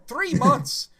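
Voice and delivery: high, loud amazed exclamation; surprised. Real, not acted. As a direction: blurt it in disbelief. Surprised at time passed.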